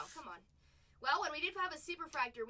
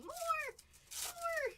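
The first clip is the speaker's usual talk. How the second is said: high squeaky cry; 14 semitones higher than usual. Real, not acted. Playful high call.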